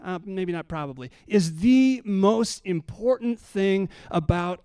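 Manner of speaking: high-pitched, driving sermon delivery; impassioned preaching. Real, not acted. Preacher assures church that God loves us, impassioned. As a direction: preach with mounting urgency, pitch held high.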